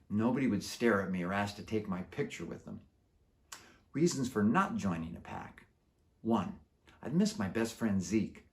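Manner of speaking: plain reading voice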